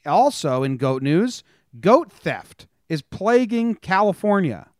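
slow, punchy newsreader delivery with dry jokes; news read. Acted. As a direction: read the headlines like a mock anchor, hitting each story. Reads news headlines.

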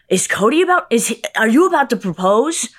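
pushy tipsy stranger, overexcited; nosy drunk fan. Retold or imitated. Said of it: "And she was like".

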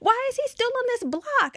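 very high mock panic; hammed-up, high-pitched alarm with laughter; imitating panicked friend. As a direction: repeat the friend's freak-out way up high.

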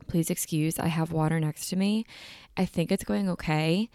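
normal talking voice

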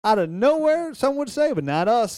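high, loud and excited. Real, not acted. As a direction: brag it with glee. Excited, mock-boastful claim.

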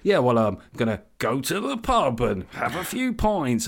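put-on ironic middle-aged voice; mock the ironic delivery with swooping stresses; Alan Partridge. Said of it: "they speak like Alan Partridge".

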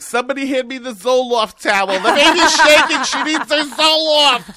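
very high, very loud comic mother's yell; hollering Italian mom. Acted. Mom yelling across the pool for a towel, hollering. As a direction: holler it drawn out, high and frantic. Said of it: "but my mother".

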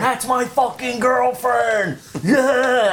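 high, slow Philly yell; outraged Philly guy. Retold or imitated. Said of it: "the guy next to me is going".

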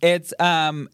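ordinary delivery

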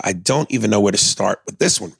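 ordinary talk, nothing marked

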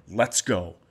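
ordinary talk

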